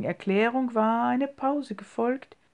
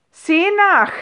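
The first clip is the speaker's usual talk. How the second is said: much higher, loud, fierce command; vehement shout; 9 semitones higher, 11 dB louder than usual. A narrator's reading. Uncle fiercely orders Emilie to go and look, loud and high. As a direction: bark the order sharply. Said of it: "rief der Onkel so heftig".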